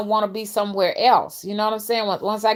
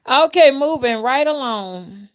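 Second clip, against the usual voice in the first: higher, louder, slow, still chuckling; amused repeat; 6 semitones higher, 6 dB louder, slower than usual. Real, not acted. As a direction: say it again through leftover laughter.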